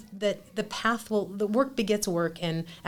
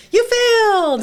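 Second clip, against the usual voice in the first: high, loud cheer of a teacher praising failure; triumphant mock-teacher shout; celebratory yell; 14 semitones higher, 12 dB louder, slower than usual. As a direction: shout it out like a proud professor. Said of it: "he would go".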